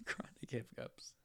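slow whispered echo. Real, not acted. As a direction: breathe it out under your breath. Quiet echo of partner's joke.

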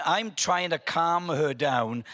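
ordinary talk, nothing marked

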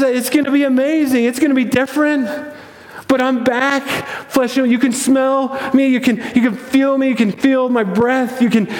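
high, bubbling joyful voice; full of delight at being back. Acted. Risen Jesus tells Mary he is back, high and joyful.

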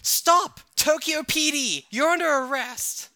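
loud, high shout over the noise; shouting over a crowd. A narrator's reading. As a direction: yell to be heard over the crowd. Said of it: "She yells over the noise of the crowd".

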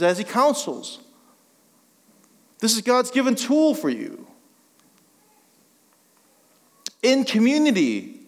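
high, emphatic sermon delivery; preaching. Real, not acted. Pastor telling church about discerning in community, emphatic preaching. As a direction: preach it with conviction.

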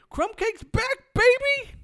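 high, loud hype shout; hype catchphrase. Retold or imitated. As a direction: belt it like a wrestler's catchphrase. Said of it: "That's what he would say".

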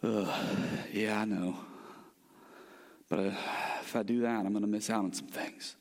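grudging, lazy sigh of an answer; reluctant groan. Retold or imitated. Our reluctant side answering the Bible, a grudging groan. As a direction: groan it like a kid told to clean up. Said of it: "a part of us just goes".

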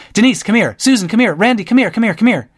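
excited calls to office mates across the room; calling out. A narrator's reading. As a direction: summon coworkers urgently.

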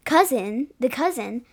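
ordinary talk, nothing marked

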